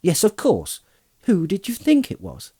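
put-on high, breezy young hobbit; Merry. A narrator's reading. Merry to the astonished farmer, breezy.

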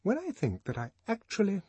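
a high-pitched headmistress tutting at gossip; headmistress of Eton. A narrator's reading. Headmistress complaining to the director, high disapproving voice. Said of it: "the headmistress of Eton was saying".

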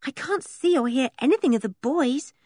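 high, anxious little voice; mildly frightened girl's voice; fearful. A narrator's reading. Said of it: "said Anne fearfully".

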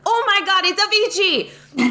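high, excited eureka; the penny drops all at once; a high, surprised retold discovery; sudden realization.

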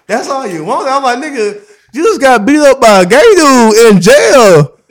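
high, loud, slowing incredulous taunt; mocking disbelief. Man mocking his beaten cellmate, loud disbelief.

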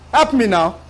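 ordinary talk, nothing marked

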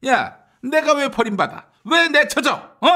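high-pitched offended questioning; indignant protest. Acted. Candidate told he will be dumped, indignant protest. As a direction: protest hotly, pitch jumping up.

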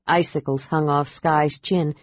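plain reading voice

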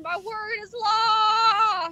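slow booming mock decree; roaring tyrant king. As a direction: proclaim it like a tyrant ruler. Original decree.

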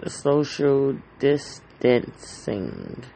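social distancing stretched out slowly, each word leaned on; slow drawn-out repeat. Shown as a demonstration. Podcaster warning listeners about the virus, slow and drawn out.

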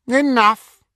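high, loud sharp order; loud command. A narrator's reading. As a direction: cut the laughter off hard. Said Voldemort.

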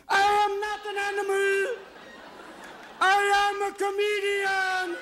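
very high, slow, loud pleading cry; Elephant Man. Retold or imitated. Playing the Elephant Man in the fur, crying out high and slow. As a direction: wail it out slowly like the Elephant Man. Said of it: "we can play Elephant Man going".

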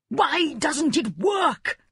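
high, slow screaming king; mad king ranting. A narrator's reading. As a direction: scream it at the charlatan. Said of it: "the king screamed at the charlatan".

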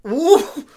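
loud falsetto whoop; high "ooh". Real, not acted. Hearing the billionaire's porn rumour, high whoop. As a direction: let out a high, startled ooh.

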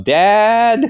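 high, loud call for dad; yelling for dad. Acted. Scared kid yelling for dad in the other room. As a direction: yell out to dad from the chair. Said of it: "and then I sort of yell".

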